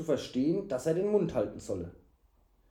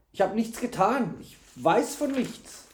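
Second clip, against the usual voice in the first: high-pitched plea of innocence; whining protest; 7 semitones higher than usual. A narrator's reading. Servant rubbing his arms, whining protest. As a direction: whine that you are blameless.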